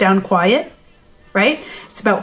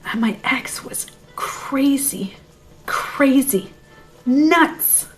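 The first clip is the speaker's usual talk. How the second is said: hushed conspiratorial whisper; 3 semitones higher, 5 dB quieter, much slower than usual. Real, not acted. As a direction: lean in and whisper it. Dramatic aside about crazy ex.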